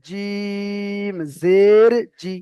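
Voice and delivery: জিম জের জি with জি held very long; long drawn vowel. Shown as a demonstration. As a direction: hold the জি for three alif lengths. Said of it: "তিন আলিফ টান দিয়ে বলতে হবে". (তিন আলিফ টান দিয়ে জিম জের জি)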